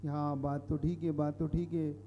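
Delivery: a lightly voiced convinced follower; misled listeners agreeing. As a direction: murmur along like someone being won over. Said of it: "उसका तर्क मान के कई लोग भटक गए".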